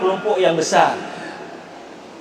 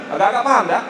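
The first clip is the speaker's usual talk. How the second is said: ordinary talk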